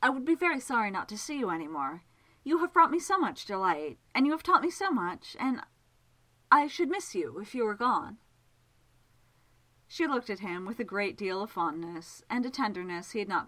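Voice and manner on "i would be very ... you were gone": higher, louder, earnest and abashed; shy man confessing he'd miss her, abashed. A narrator's reading. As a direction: blurt out an embarrassed confession. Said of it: "a little abashed by his honesty".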